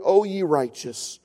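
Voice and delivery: normal talking voice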